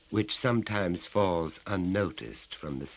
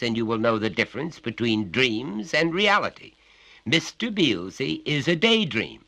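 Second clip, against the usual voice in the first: stern father; 7 semitones higher, 7 dB louder than usual. A narrator's reading. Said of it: "said his father".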